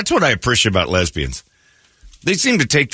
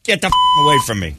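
shooing someone off; 6 semitones higher than usual. Pretend. Shooing off a stranger.

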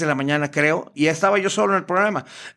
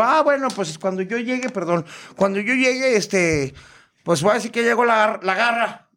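higher, hesitant camp caller; La Garra; 5 semitones higher than usual. Retold or imitated. Prankster makes up a nickname on air, high hesitant camp voice. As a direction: improvise a name in a put-on high voice. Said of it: "Y yo".

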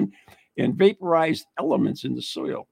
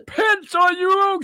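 preacher; 6 dB louder, slower than usual. Pretend. Mock preacher after ball through church.

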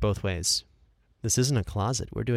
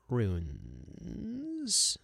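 breathy, mysterious whisper on runes; 7 dB quieter than usual. Shown as a demonstration. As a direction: hush the word like a secret. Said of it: "I'm gonna do that a couple different ways".

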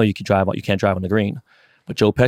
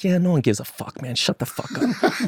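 much higher, slow, breathy put-on gangster voice; mock Pesci brush-off; 7 semitones higher, 4 dB quieter than usual. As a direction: ham up the tough-guy sneer, drawn out. Pesci brushing off his putt.

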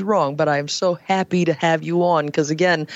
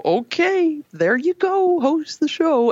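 high, breezy send-off; playful handover; 11 semitones higher than usual. Pretend. Host handing a random listener the chair, playful high-pitched send-off. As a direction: toss the job over in a much higher voice.

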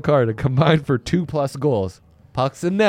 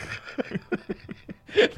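high breathy laugh; 14 semitones higher, 6 dB quieter than usual. Real, not acted. Laughing at bet slip.

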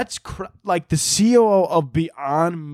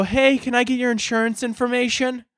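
timid polite; 9 semitones higher than usual. Retold or imitated. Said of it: "and you go".

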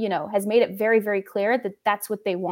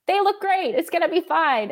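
mock naive optimism; 8 semitones higher than usual. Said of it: "I went into twenty twenty-two thinking".

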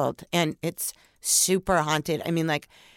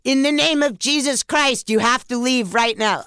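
a commanding voice, pitched up; telling the spirit to leave, in a commanding voice; butch voice; 5 semitones higher, 4 dB louder than usual. Retold or imitated. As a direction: order the spirit out with authority. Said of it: "I just said in a really butch voice".

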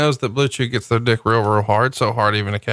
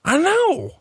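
incredulous. Real, not acted. Agreeing in mock disbelief.

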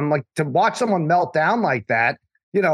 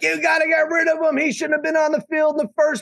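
crazy rant; 12 semitones higher than usual. Pretend. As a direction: go off like an unhinged fan. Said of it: "I could go crazy and be like".

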